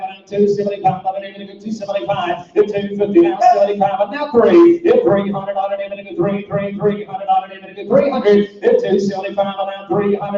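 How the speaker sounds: fast rolling auction chant; auctioneer. Acted. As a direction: rattle off bids like an auctioneer.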